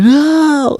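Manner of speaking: very high, loud mock wail; crying child's refusal. Father imitating his crying son's refusal, high squeal. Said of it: "'cause when he's crying".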